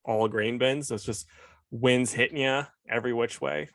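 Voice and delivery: normal talking voice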